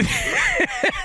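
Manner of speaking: shrill burst of laughter; real high laugh. Real, not acted. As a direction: keep laughing hard. Still laughing.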